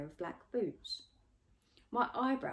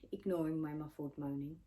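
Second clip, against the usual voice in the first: ordinary delivery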